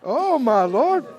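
chuckling through oh my Lord; laughing disbelief. Acted. Gideon laughing off the angel's greeting, embarrassed. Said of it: "Gideon said".

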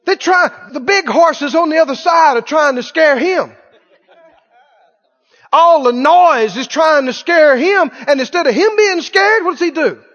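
high-pitched, driving sermon delivery; fervent preaching. Real, not acted. Preacher describing noise trying to scare the horse, fervent. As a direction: preach it with rising fervor.

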